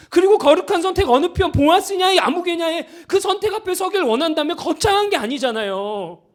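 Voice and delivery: impassioned sermon rebuke; fiery preaching. Acted. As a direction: drive the rebuke home to the congregation. Preaching at full force.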